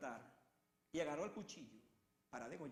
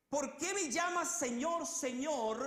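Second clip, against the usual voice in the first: high, loud, slow "¿Por qué me llamas Señor, Señor?"; loud rebuke; 7 semitones higher, 9 dB louder, slower than usual. Acted. Preacher voices Jesus rebuking those who call him "Señor, Señor", loud and slow. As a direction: hammer the repeated "Señor, Señor" with force. Jesus asks again loudly.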